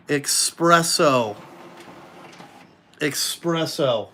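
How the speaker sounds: slow, deliberate "espresso"; drawn-out naming. Real, not acted. Man showing off his new desk, drawn-out naming. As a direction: stretch each syllable of "espresso". Showing off the desk's colour name.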